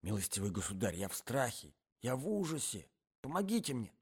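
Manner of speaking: hushed panic. Acted. Hidden man pleads with his companion for help, hushed panic. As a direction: beg for help in a frightened undertone. Terrified man hiding under bed.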